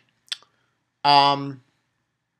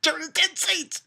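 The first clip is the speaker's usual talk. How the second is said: much higher and louder, excited burst; hyped reaction; 13 semitones higher, 5 dB louder than usual. Retold or imitated. Fan reacting as Jura shows up, hyped shout. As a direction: blurt it out, thrilled to see him. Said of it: "I was like".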